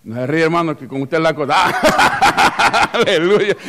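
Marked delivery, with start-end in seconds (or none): 1.7-3.7 laughing out between words. Real, not acted. Pastor teases a single member and laughs. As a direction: crack up at his own joke.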